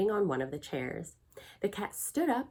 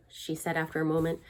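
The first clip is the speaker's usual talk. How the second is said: ordinary delivery, nothing marked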